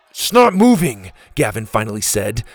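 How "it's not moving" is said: loud, raised-pitch frustrated cry; urgent frustration. Acted. Gavin struggling at the gate, urgent frustration. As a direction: give up on the stuck lock. Gavin failing to open lock.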